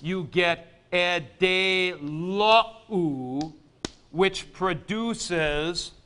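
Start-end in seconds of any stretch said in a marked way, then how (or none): 0.0-3.5 e de lo u drawn out syllable by syllable; slow syllable demo. Shown as a demonstration. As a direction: slowly sound out e de lo u. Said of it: "with the second singular imperfect middle".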